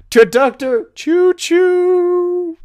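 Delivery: high, drawn-out train whistle imitation; chug, then a long high choo-choo; train noise. Joker mimicking the departing train, whistling.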